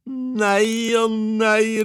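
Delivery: very high, loud, drawn-out exclamation; delighted cry. A narrator's reading. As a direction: cry out slowly in sheer delight as the wife. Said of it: "ropte kona".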